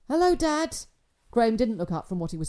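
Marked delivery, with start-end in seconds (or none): 0.1-0.8 lightly voiced teenage son, higher, louder; flat dutiful reply from a distracted teen; son's voice. A narrator's reading. Son answers his dad without looking up, lightly voiced. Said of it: "Hello?" I said".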